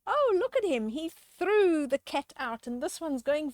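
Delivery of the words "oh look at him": usual speaking voice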